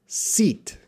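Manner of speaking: long ee in "seat", lips spread wide. Shown as a demonstration. Teacher modelling "seat" as a long sound. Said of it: "almost smile".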